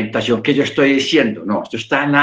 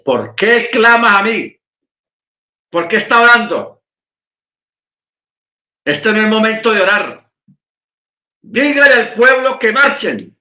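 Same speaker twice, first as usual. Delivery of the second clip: raised pitch, insistent divine scolding; commanding rebuke; 6 semitones higher, 3 dB louder than usual. Acted. God telling Moche to stop praying, commanding rebuke. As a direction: challenge him, then give the order.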